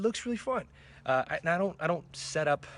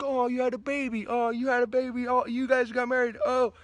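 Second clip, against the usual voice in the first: mock gushing reactions; 12 semitones higher, 6 dB louder than usual. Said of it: "I'm like".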